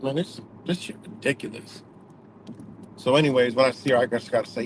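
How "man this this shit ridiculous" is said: muttered under breath. Retold or imitated. Muttering at the rude attendant.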